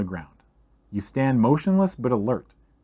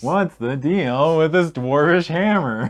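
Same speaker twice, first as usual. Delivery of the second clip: lightly done slow standup comic voice; a hint of a sitcom comedian's setup line; Jerry Seinfeld; 4 semitones higher, 4 dB louder, slower than usual. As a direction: ask it like a stand-up bit, slowly. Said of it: "sound like a Jerry Seinfeld skit".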